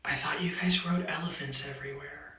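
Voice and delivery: unvoiced whisper of the quote; whispering the friend's serious question; whispered seriously. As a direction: hush it like a confidential fact. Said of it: "very seriously looked at me and said".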